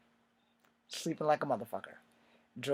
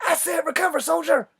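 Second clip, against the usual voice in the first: high, loud barked command; hammed-up sergeant shout; drill sergeant yell; 9 dB louder than usual. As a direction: yell the order at him. Sergeant yelling at sleeping recruit.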